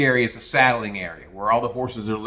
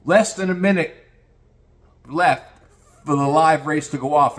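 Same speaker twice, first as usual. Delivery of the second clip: ordinary talk, nothing marked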